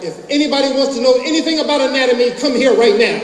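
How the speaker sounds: high, loud, rapid summons; yelling out. Retold or imitated. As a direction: holler urgently to the whole room. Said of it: "yell out".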